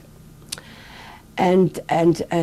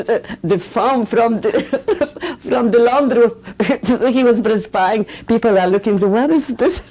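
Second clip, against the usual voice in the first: higher, giggling through the words; laughing through the story; 7 semitones higher, 4 dB louder than usual. Real, not acted. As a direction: laugh as you tell it, amused. Laughing while retelling son's embarrassment.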